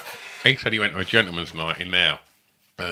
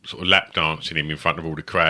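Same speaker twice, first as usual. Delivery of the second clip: normal talking voice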